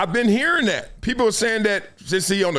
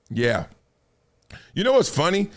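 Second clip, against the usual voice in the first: ordinary talk, nothing marked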